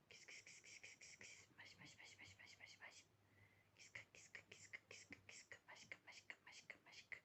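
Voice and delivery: faint whispered kis-kis; whispered kitty calling. Real, not acted. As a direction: call the cat in a whisper.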